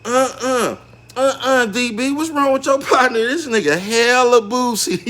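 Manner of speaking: high-pitched put-on woman complaining, slowing at the end; mock woman's voice. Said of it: "She like".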